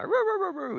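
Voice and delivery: high yipping roo roo like a sleeping dog; high dreaming dog whimper. Retold or imitated. Streamer imitating his dog's dream barks, high soft yips. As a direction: mimic a dog barking in its sleep.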